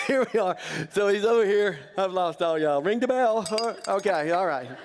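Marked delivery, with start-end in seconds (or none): 0.1-0.6 laughing as you repeat it; amused half laugh. Real, not acted. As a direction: say it through a chuckle.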